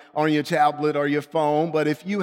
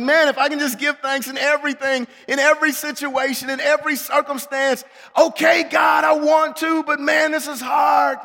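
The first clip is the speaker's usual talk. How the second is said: high, strained-effort protest, building louder; exasperated plea; 8 semitones higher, 3 dB louder than usual. Acted. Preacher telling God he is struggling, exasperated. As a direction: build to an exasperated near-shout.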